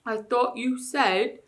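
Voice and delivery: slow, puzzled bear voice. Acted. Bear slowly puzzling over the ape's words. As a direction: think it out slowly, a dim bear. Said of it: "began the bear".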